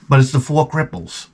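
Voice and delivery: usual speaking voice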